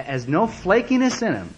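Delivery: ordinary delivery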